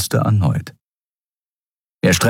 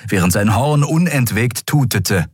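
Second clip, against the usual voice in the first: ordinary delivery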